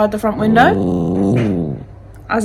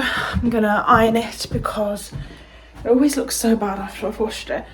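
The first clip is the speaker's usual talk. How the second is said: normal talking voice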